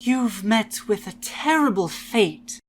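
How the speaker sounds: eerie cheerful salesman voice; sweet and unsettling, then snapping; creepy polite merchant; Happy Mask Salesman. Acted. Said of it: "The Happy Mask Salesman".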